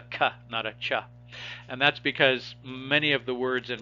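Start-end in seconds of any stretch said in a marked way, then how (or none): 0.0-0.3 K said as a short "kuh"; a plain hard "kuh" for K; hard K sound. Shown as a demonstration. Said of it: "you usually pronounce it as a K". 0.8-0.9 ch said as a short "chuh"; soft ch sound. Shown as a demonstration. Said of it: "you usually pronounce it as a K".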